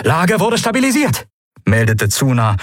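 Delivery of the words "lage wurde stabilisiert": high-pitched, astonished report; half proud, half amazed. A narrator's reading. Lieutenant reporting the stabilised position, half proud, half amazed. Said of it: "halb stolz, halb verblüfft".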